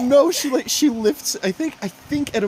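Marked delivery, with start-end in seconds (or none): none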